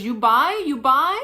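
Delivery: mock vendor asking again; broken English. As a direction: pester like a pushy vendor. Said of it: "she goes".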